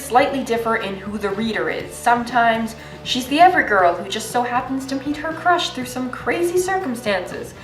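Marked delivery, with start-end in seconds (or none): none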